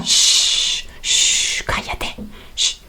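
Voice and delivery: whispered shh, then a hushed cállate; shushing. Pretend. Teacher hushing the class to recall sprechen. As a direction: hush someone like a librarian. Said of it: "prepararnos para hablar o para callar".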